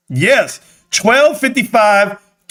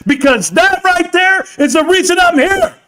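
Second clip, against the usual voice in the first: yelling; 7 semitones higher than usual. Real, not acted. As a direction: yell it out with pure hype. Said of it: "I don't mean to yell".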